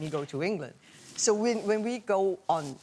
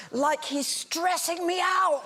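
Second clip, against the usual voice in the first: drawn-out, high-pitched fan wail; hammed-up lovesick complaint; swooning exasperation; 9 semitones higher, slower than usual. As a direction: wail it slowly, high and overwhelmed. The last sentence is.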